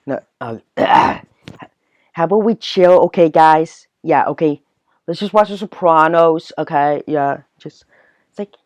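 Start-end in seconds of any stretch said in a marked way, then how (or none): 0.8-7.8 loud, hammed-up New Jersey accent; forceful Jersey Shore caricature. Shown as a demonstration. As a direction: stretch it out like a Jersey Shore bro. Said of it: "sounds like the Jersey Shore one".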